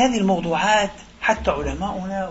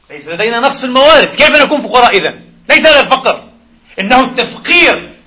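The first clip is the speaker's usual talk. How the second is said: rising, louder, faster preacher's cry; fiery preaching; 5 semitones higher, 6 dB louder than usual. Real, not acted. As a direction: hammer the point home to the congregation. Fervent climax of the sermon. (بحماس خطابي متصاعد)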